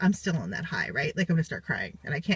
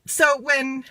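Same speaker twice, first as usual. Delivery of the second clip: normal talking voice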